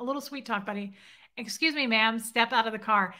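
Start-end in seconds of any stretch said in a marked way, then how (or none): none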